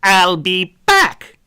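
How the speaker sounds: loud, slow "I'll be back"; menacing farewell from the vanished thief; loud ominous vow. A narrator's reading. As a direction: promise it slowly, echoing and loud. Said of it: "a voice loud and clear".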